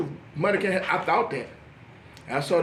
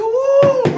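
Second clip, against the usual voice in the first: high, loud "woo"; horrified whoop; 8 dB louder than usual. Retold or imitated. Doctor reeling from exam, loud mock whoop. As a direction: whoop as if knocked back.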